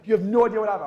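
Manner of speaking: loud, mock-dramatic outburst; overdramatic friend. Hypochondriac friend insisting to his pal, loudly dramatic. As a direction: burst out as the worked-up friend. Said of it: "Everything has to be dramatic".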